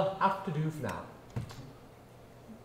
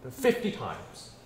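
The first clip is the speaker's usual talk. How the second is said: ordinary talk, nothing marked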